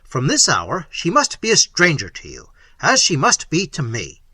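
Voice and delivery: loud, angry rebuke; Manfred angrily rebuking Isabella. Acted. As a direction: snap the reminder at her. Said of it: "said Manfred angrily".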